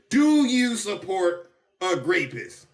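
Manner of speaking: slow, loud pointed question. Shown as a demonstration. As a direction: ask it slowly, each word landing. Demo of new question.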